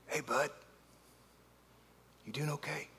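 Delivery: soft, quiet, tender check-in; gentle dad. Retold or imitated. Father gently asking his ashamed son, soft and tender. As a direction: ask it softly like a forgiving father. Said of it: "And he goes".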